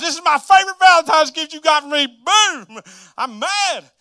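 loud, high-pitched acted rage; hammed-up furious yelling; angry outburst. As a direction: shout it like a man smashing up the room. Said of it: "I'm sure he was angry".

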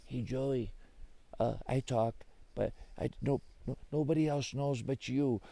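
soft, hesitant little admission; shy confession. Acted. Robin Bird admits to Joey it talks, shyly. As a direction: confess softly, with false starts.

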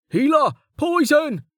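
high, desperate cries for help; urgent shouting. A narrator's reading. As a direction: yell an alarm for the wounded. Man calling for help mid-battle.

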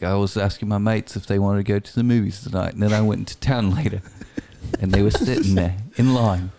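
put-on New Zealand accent. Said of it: "it reminds me of that Flight of the Conchords song".

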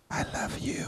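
whispered secret, voice gone. Retold or imitated. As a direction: breathe it like a child's secret.

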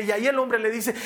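usual speaking voice

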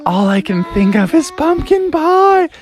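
high mock wail; hammed-up frustrated cry; exasperated Froggy. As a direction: whine it high in comic despair. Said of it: "And he's like".

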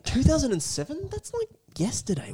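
high mocking listener voice. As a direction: squeak it like a scoffing listener. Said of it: "what the listeners are thinking".

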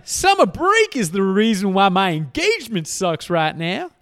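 hammed-up griping about bad luck; mock blaming complaint. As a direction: put on an exaggerated complainer. Mimicking people blaming summer break.

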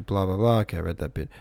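ordinary delivery

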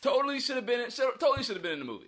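high, loud, fast fan gushing; excited fans. Retold or imitated. Actor voicing eager fans, high loud gushing.

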